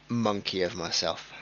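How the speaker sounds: normal talking voice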